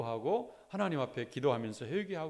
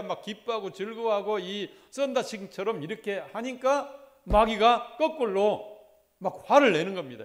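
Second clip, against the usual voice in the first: loud, driving pulpit preaching; fervent preaching; 4 semitones higher, 8 dB louder than usual. Acted. Pastor urging his church not to give in to the devil, fervent preaching. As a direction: press each phrase on the congregation.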